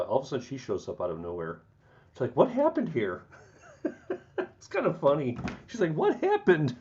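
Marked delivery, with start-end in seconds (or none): 2.4-3.2 mock surprise. Mimicking Tiara's surprised reaction. 5.9-6.8 high, squeaky mock surprise; mock surprised squeal. Mimicking Tiara again.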